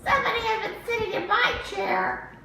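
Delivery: very high, fast little cub; high quick cub voice; baby bear. Acted. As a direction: squeak it out like a tiny bear. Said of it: "said Baby".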